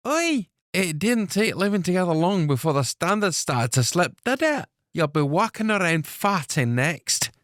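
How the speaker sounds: put-on playful scold from a young woman; Alice's voice.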